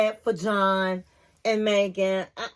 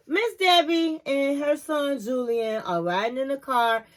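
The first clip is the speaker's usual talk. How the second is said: ordinary talk, nothing marked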